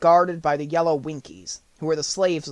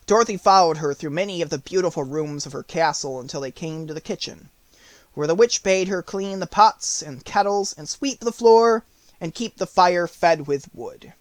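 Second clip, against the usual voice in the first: plain reading voice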